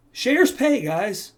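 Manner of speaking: high, loud punch on shares pay; a pumped-up call to his viewers; excited emphasis. Real, not acted. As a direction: sell it with a sudden burst of enthusiasm. Hyping his favourite strategy.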